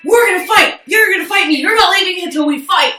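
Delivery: high mock shout at full force; shrill-pitched taunting yell, acted out; imitating her yelling. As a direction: yell it like the girl itching to fight. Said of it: "She's like".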